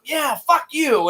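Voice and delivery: high, drawn-out mock retort; excited retelling. Bert retorts to Spider in retold film, excited. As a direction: fire back excitedly, stretching it slowly. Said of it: "I get really excited sometimes".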